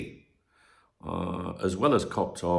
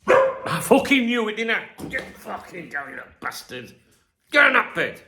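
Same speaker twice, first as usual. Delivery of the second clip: loud, higher, fed-up shouting at the dog; real yell at dog; 12 semitones higher, 6 dB louder than usual. Real, not acted. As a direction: bark the order at a noisy dog. Yelling at barking dog.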